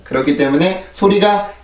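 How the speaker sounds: normal talking voice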